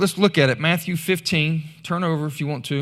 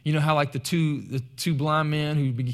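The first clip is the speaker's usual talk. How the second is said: usual speaking voice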